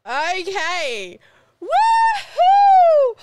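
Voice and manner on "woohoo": very high, loud whooping cry; copying the coach with a big woohoo; exclamation. Shown as a demonstration. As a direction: let it rip like at a party. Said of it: "So we go".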